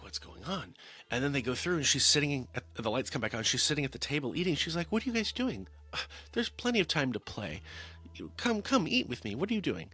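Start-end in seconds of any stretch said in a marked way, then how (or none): 0.0-0.7 hushed, wary question; acted-out hushed bewilderment; mock spooked whisper. Acting out spooked family's question. 4.9-9.9 high, breezy put-on voice; play-acted innocent puzzlement; feigned innocence. Acting out Lucia feigning innocence.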